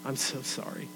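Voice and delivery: soft, mildly crushed confession; mock crushed. Teenager saying sorry to God after sinning, mildly crushed. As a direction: act out a hushed apology to God. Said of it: "so crushed by it".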